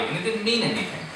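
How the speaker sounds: ordinary talk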